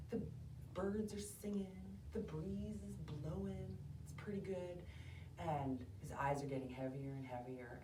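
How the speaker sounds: hushed, low storytelling; soft, drowsy bedtime tone; sleepy lullaby narration. A narrator's reading. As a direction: lull them gently toward sleep. Narrating the hare falling asleep.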